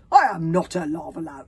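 loud, emphatic denial; offended, forceful denial; indignant protest. A narrator's reading. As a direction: protest loudly, affronted. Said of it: "protested the man indignantly".

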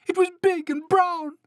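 very high, loud mouse squeak; squeaky storybook panic; frightened mouse cry. A narrator's reading. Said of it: "cried the mouse".

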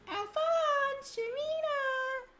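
squeaky falsetto names; high-pitched voice. Acted. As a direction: call out in a high voice. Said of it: "how do I do Sharita".

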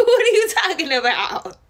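real laughter breaking through, high; laughing. Real, not acted. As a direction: crack up at the memory. Laughing at her own story.

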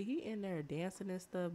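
usual speaking voice

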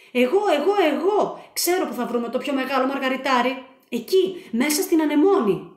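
loud, high, pushy excitement; eager show-off. A narrator's reading. Frixos pushes ahead of his team, loud and eager. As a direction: jump in first, grabbing the spotlight. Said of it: "είπε ο Φρίξος".

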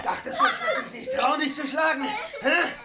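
high, jeering laugh through the threat; mocking threat. Husband taunting his wife before hitting her, jeering laugh.

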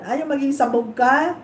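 ordinary talk

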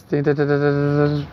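mimicking a phone chime with the voice; sing-song ding ding like a notification; imitated ding.

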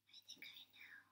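tiny far whisper; puppet whisper. Acted. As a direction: whisper it shyly to the side. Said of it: "He thinks that he knows".

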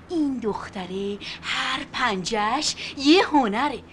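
normal talking voice

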